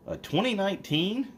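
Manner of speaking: can't believe what he's hearing; incredulous high question. Retold or imitated. To the trustee, a high incredulous question. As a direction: pitch jumps way up in disbelief.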